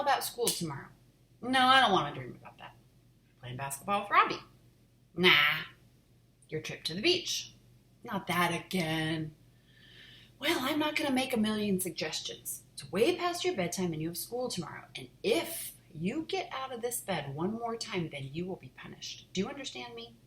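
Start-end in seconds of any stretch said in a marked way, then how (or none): 1.4-2.7 loud whiny protest; protesting child. A narrator's reading. 10.4-20.1 firm scolding mother; stern mom. A narrator's reading.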